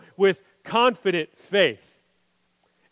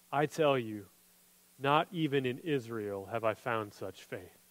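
much softer and lower, reverent; hushed awed quote; 10 semitones lower, 11 dB quieter than usual. Retold or imitated. Jesus telling the crowd about this faith, hushed. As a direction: say it low as if in awe. Jesus' words read quietly.

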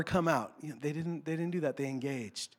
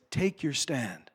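soft reading; 5 semitones lower, 6 dB quieter, slower than usual. Shown as a demonstration. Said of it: "could better be translated as".

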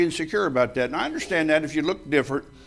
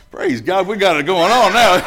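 louder, laughing praise; gleeful relief; 3 semitones higher, 7 dB louder than usual. Retold or imitated. Sailor relieved backup arrived, laughing loud praise. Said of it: "I thought".

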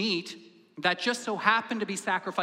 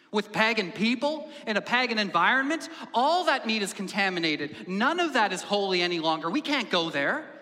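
loud, raised-pitch acted tirade; indignant objection; 4 semitones higher, 5 dB louder than usual. Sally jumps into the meat quarrel, indignant objection. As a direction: hammer the objection home in mock outrage.

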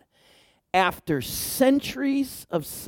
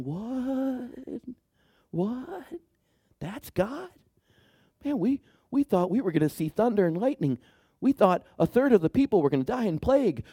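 higher, disbelieving "what?" on a hush; mock crowd baffled by a gentle God; astonished crowd; 5 semitones higher, 4 dB quieter than usual. Crowd reacting in surprise to God's voice, higher and hushed. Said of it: "all the people are going".

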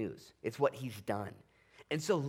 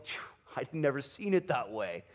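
mock amazed. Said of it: "go and say".

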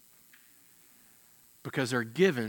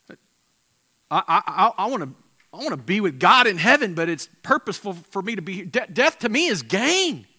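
voicing Paul; 5 semitones higher, 8 dB louder than usual. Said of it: "he's like".

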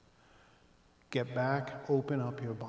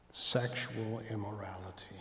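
whisper; 5 semitones lower, 5 dB quieter than usual. Acted. Whispering a taboo phrase.

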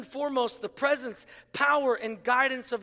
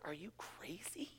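hushed, almost whispered incredulity; mock disbelief; 6 semitones lower, 22 dB quieter than usual. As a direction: play stunned, barely letting the words out. Retold incredulous reply to dad.

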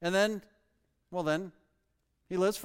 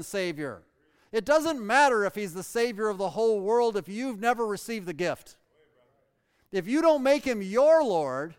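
louder, higher pulpit preaching; preaching at full force; 5 semitones higher, 7 dB louder than usual. Acted. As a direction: drive it home to the congregation. Preaching climax.

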